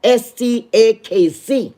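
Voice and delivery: slow, separate letters; one deliberate letter at a time; spelling it out. Shown as a demonstration. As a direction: spell it slowly for the listeners. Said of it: "let me spell the fucking name".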